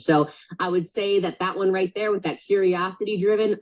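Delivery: ordinary talk, nothing marked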